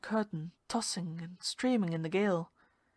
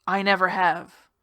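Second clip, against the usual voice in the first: louder, hard denial; angry, loud refusal; denial struck out; 9 dB louder than usual. Acted. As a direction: strike back with the words. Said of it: "I struck out at her".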